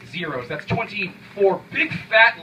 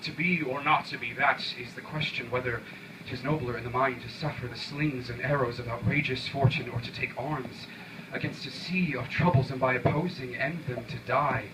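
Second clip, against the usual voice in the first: lower, softer solemn verse reading; hushed theatrical recitation; Shakespearean recital; 4 semitones lower, 5 dB quieter than usual. Acted. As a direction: intone it like a grand Hamlet soliloquy. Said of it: "Were I Shakespeare and mine were to read".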